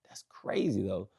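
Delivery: hushed, unvoiced aside; whispered aside. Real, not acted. Comic muttering to himself in a whisper. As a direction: drop to a breathy whisper for yourself.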